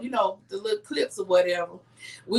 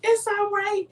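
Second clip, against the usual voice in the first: high-pitched, drawn-out soothing lilt; imitating calm husband; 10 semitones higher, slower than usual. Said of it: "the way I'm telling y'all is the way he said to me".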